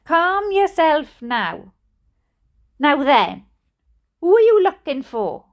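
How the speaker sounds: firm nasal questioning, measured at first; deep nasal accent. A narrator's reading. Burly nurse calming frantic mother, nasal accent. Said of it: "in a deep nasal accent".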